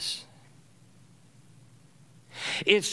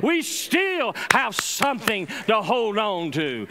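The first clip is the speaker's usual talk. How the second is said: full-force preaching; 8 semitones higher than usual. Real, not acted. Preacher building to a climax for the congregation, full force. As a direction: preach it out to the church, rising.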